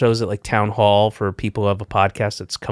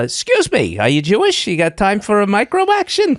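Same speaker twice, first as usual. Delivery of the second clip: street canvasser voice; 10 semitones higher, 3 dB louder than usual. Retold or imitated. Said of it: "And like".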